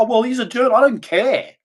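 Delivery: high sneering imitation; mocking people. Mocking critics who call him a jerk, high voice. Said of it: "people are".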